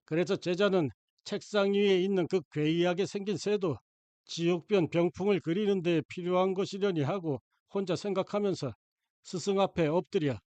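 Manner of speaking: usual speaking voice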